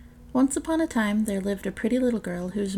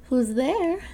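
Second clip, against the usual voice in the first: grandmother calling out; 5 semitones higher, 4 dB louder than usual. Acted. Grandmother answers the door.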